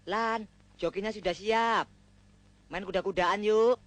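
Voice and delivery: mock bedroom line. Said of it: "kamu bilang begini".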